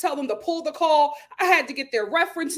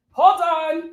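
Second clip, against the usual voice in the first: louder, higher call; 5 semitones higher, 7 dB louder than usual. Real, not acted.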